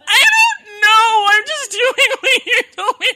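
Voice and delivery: very high, loud mock crying; hammed-up helpless wail, words stretched out; tearful wail. Patient defending herself to her doctor, mock tearful wail.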